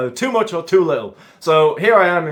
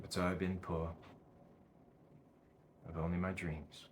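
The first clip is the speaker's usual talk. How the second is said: low, very soft, resigned film monologue; hushed and deep, with long heavy pauses; doomed man's monologue; 9 semitones lower, 23 dB quieter than usual. Acted. Performs Equilibrium monologue to camera.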